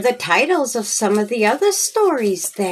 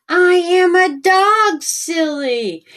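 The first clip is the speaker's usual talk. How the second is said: put-on higher, louder cheeky kid voice; playful boy; 10 semitones higher, 3 dB louder, slower than usual. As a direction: tease like a little boy. Boy playing dog answers.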